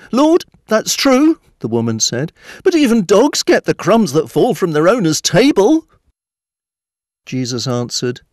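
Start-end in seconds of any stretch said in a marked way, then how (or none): 0.1-1.4 slow, high, loud reply rising at the end; pleading woman. A narrator's reading. Woman pleading in acted story. 2.6-5.9 high, loud insistent plea; pleading woman. A narrator's reading. Woman pleading in acted story.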